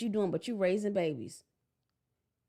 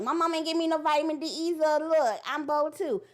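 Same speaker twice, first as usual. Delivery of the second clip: high, loud showing-off voice, slowing at the end; mock a girl proudly showing off her legs; 6 semitones higher, 4 dB louder than usual. Copycat girl bragging about her bow legs, mocking. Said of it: "Look like".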